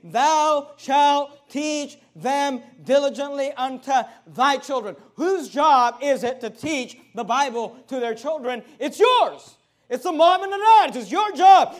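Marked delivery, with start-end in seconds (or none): 0.0-5.0 slow, loud, high sermon reading of scripture; preaching scripture. Acted. Pastor reads scripture in preaching voice. 8.8-11.8 much higher and louder, hammering home the point; preaching at full force. Acted.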